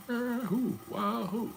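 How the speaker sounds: very high, ringing mock whoop of Wahoo; hammed-up fan chant, way up high; mock war whoop. Mock cheer for Chief Wahoo.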